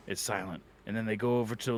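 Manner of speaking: normal talking voice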